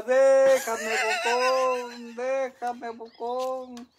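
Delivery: long, high, loud teasing wail; mock cry. Dad drowning out his daughter's act, loud mock cry. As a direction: belt out a drawn-out imitation of screaming.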